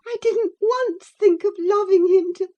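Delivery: high, loud tearful protest; sobbing girl. A narrator's reading. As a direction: protest through sobs. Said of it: "sobbed Cathy".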